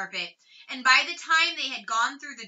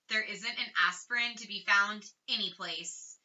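usual speaking voice

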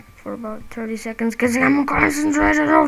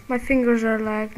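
ordinary delivery

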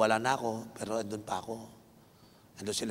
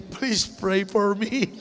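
laughing mock plea; 7 semitones higher, 4 dB louder, much slower than usual. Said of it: "Tapos sabihin".

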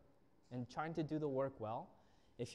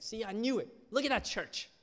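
higher, louder, gloating told-you-so voice; mock the smug critics of the church; 7 semitones higher, 9 dB louder than usual. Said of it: "a lot of Christians see these kind of stories and be like".

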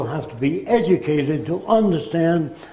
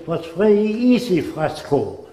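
put-on German accent; 4 semitones higher than usual. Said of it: "That's the way he spoke".

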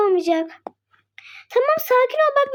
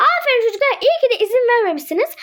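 ordinary talk

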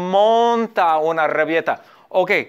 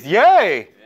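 happy cheer; 6 dB louder than usual. Acted. Stepmother is happy.

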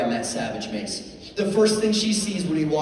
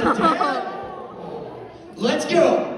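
put-on eager, menacing kid demand; savage kid; 13 semitones higher, much slower than usual. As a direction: pitch it up like a possessed little daredevil. Savage daughter picks the worst ride.

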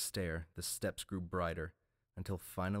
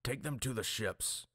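higher barked order; lightly voiced command; 6 semitones higher than usual. A narrator's reading. Torwald ordering the mutes, a hint of a bark. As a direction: order them off.

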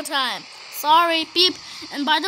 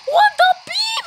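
shocked shout; 5 dB louder than usual. Real, not acted. Shocked reaction to the game.

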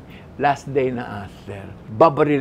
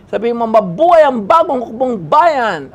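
loud instruction; 8 semitones higher, 11 dB louder than usual. Retold or imitated. Director instructing loudly.

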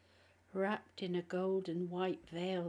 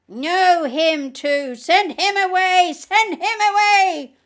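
demon's wail; 11 semitones higher, 19 dB louder than usual. A narrator's reading. Demon begging for the newcomer to be sent away, loud wail. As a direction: wail it out at full volume like a demon.

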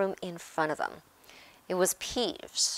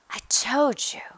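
breathless, higher-pitched gasp; 6 semitones higher, 5 dB louder than usual. A narrator's reading. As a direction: gasp it out, winded from running. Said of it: "Hermione gasped".

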